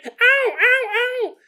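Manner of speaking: very high squeaky ows; tiny mushroom cries. Acted. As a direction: yelp like a kicked mushroom.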